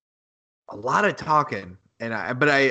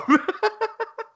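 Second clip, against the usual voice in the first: high laugh. Real, not acted. Reacting to the match recap, real high laugh. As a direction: burst of high-pitched laughter.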